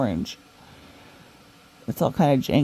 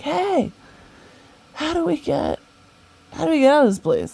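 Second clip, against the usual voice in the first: calling out; 11 semitones higher than usual. Retold or imitated. Calling to a guy on a balcony for a way out, urgent shout. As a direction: shout up to the man above, urgent.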